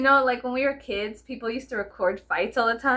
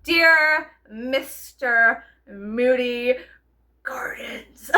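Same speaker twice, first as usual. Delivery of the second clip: slow, loud, prim letter voice; 6 dB louder, much slower than usual. Retold or imitated. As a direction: stretch it out like a posh valley girl.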